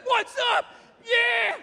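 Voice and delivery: excited gorilla. Pretend. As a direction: play a pumped-up gorilla greeting its friend. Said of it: "So the thing's like".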